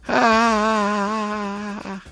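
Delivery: high, wailing "Ah!" of regret; anguished cry. Acted. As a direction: let out a despairing wail. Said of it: "diye söylenip durmuş". (pişmanlıkla haykırış)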